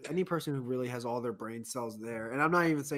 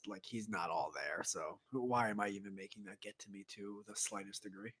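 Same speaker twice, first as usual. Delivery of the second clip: much lower and softer inner voice; self-talk, hushed; muttering to self; 6 semitones lower, 9 dB quieter than usual. Retold or imitated. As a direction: mutter it under your breath. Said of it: "I'm just like".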